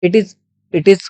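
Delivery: ordinary talk, nothing marked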